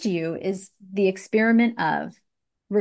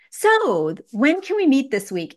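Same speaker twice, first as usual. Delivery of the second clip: louder, higher, confident request; assertive ask; 5 semitones higher, 4 dB louder than usual. Shown as a demonstration. Coach shows client how to push for a meeting, assertive. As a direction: ask it as a given, stressing WHEN. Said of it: "you say it like that".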